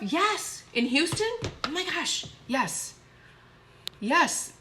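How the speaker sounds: high, delighted bursts; thrilled. Real, not acted. Streamer invited to a red carpet event in Houston, thrilled. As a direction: exclaim it in real excitement, pitch jumping.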